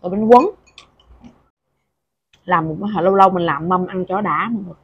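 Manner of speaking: speaking between bites; tags: talking while chewing. Real, not acted. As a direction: mouth partly full of food. Seen 4: Bite taken at -3; mouth closed chewing, new bite near 4.3.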